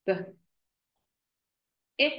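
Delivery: ordinary talk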